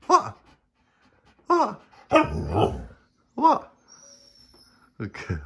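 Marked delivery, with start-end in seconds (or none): 0.1-3.7 high pet talk. Real, not acted. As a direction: chirp it up at the dog. Repeated cooing at the dog.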